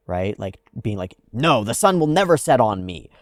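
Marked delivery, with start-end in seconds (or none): 1.3-3.1 loud, stubborn refusal to accept it; defiant insistence. Retold or imitated. Mocking someone who fights the sunset, loud and defiant. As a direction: insist the sun won't set on you, loudly.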